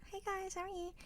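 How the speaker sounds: very high, soft company voice; sweet and proper for the in-laws; tiny high polite hello; buttoned-up greeting. Retold or imitated. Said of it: "I'm buttoned up".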